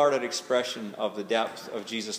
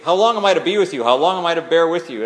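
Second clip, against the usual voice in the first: loud, raised, rapid exasperation; ticked off; 4 semitones higher, 11 dB louder, faster than usual. Acted. Jesus fed up with the people, angry outburst. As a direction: fire off the complaint at full volume, fed up. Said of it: "sounds really ticked off".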